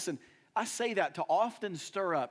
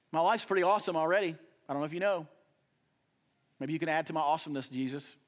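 mock breezy brag; 4 dB louder, much faster than usual. Mocking believer brushing Jesus off.

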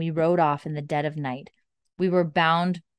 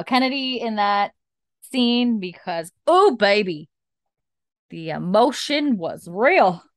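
emphatic gushing praise; 4 semitones higher, 6 dB louder than usual. Real, not acted. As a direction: lean hard into each word, impressed.

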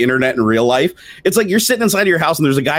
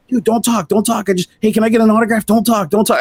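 pleading fans; 9 semitones higher than usual. Retold or imitated. Fans begging him to stop.

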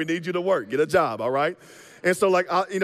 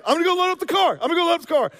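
put-on high, bright kid voice, much higher and louder; eager nine-year-old; 11 semitones higher, 5 dB louder, much faster than usual. Child telling mom she'll load the car, high eager voice. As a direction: play an over-responsible child rushing to help. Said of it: "you're like".

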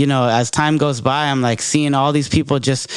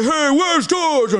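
much higher, slow, big goofy character voice; hyped coworker character; 13 semitones higher, slower than usual. Retold or imitated. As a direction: belt it like a clowning coworker making everyone laugh. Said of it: "this full-on characters".

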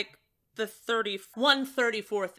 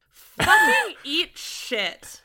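loud laugh breaking into the insult; higher, louder, laughing comeback; laughing retort; 5 semitones higher, 6 dB louder, much slower than usual. Real, not acted. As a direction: curse them out through laughter. Laughing retort to hometown teasing.

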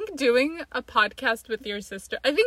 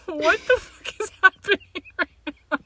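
high, disbelieving outburst; mock shock at the fake persona; incredulous sister; 8 semitones higher than usual. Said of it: "then you'll be like".

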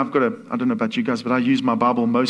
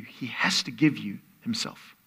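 whisper; 4 semitones lower, 7 dB quieter than usual. Real, not acted. Intimate sermon point.

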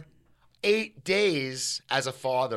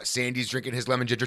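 usual speaking voice

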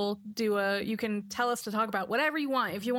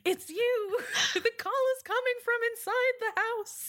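laughing through the words, pitched up; 13 semitones higher than usual. Real, not acted. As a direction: crack up while delivering the reveal.

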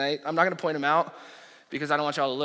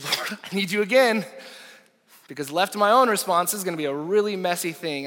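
louder, higher urgent plea; urgent prayer; 4 semitones higher, 5 dB louder than usual. Retold or imitated. Pastor praying before facing the drunk father, urgent. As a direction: cry out for help.

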